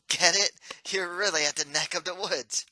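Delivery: loud, high, pleased with the joke; gleeful pun. Acted. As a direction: punch the pun with glee. Ghost laughs at own pun.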